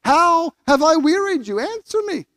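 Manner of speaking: God pleading with Israel, rising in anguish; God's anguished plea. Acted. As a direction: cry out like a hurt father. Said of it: "Verse three".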